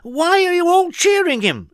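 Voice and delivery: high, loud puzzled protest; indignant cry. A narrator's reading. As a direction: protest to the crowd. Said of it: "she cried".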